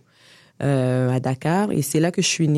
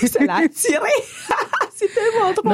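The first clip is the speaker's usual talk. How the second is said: laughing; faster than usual. Real, not acted. Laughing at funny memory.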